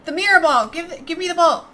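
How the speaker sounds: loud, high insistent demand; demanding shout. Acted. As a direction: yell for the ball. Demanding the ball loudly.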